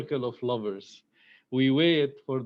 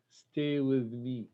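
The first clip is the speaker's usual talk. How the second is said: slow, softer Stay with me; slow softer line; 4 dB quieter, slower than usual. Acted. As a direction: draw out Stay with me slowly and gently. Reads poem line tenderly.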